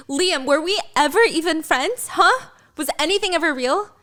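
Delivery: high, pleading accusation; hurt angry outburst. Acted. Violet confronting her friend Liam, hurt and angry. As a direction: demand it, near tears.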